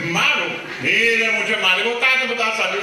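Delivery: hammed-up doctor; mock doctor. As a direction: announce it theatrically. Retold diagnosis, acted.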